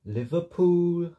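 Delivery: high, loud, drawn-out Liverpool accent, pitch climbing. Shown as a demonstration. Showing how Liverpool people talk, exaggerated Liverpool accent. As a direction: say Liverpool slowly, lifting up at the end, in Scouse. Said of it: "like, up at the end".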